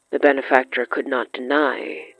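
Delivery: normal talking voice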